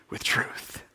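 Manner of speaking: hushed whisper for mystery. Acted. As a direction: breathe the words like a secret. Preacher drops to whisper.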